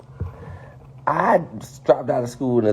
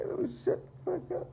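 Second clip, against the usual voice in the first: hushed self-scolding; 15 dB quieter than usual. Real, not acted. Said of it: "Let me be quiet".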